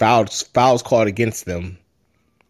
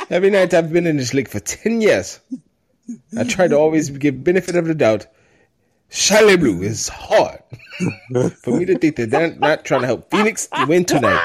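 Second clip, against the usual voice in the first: higher, hammed-up French accent with laughs; mock French accent; 6 semitones higher than usual. Host reads Gobert's quote in a mock French accent. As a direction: play a whining French player in a jokey accent. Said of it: "you gotta read it in his voice, bro".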